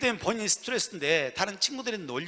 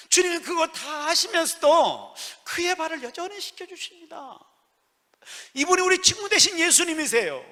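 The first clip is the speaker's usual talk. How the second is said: raised, high-pitched pulpit proclamation; fervent preaching; 10 semitones higher, 7 dB louder than usual. Acted. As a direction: proclaim it with force to the congregation. Preaching at full force. (힘주어 외치는 설교)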